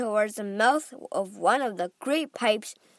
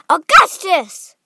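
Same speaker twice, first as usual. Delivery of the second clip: loud, high, drawn-out call; mother hollering for her son; 7 semitones higher, 16 dB louder, slower than usual. A narrator's reading. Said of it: "called out Missus Gloop".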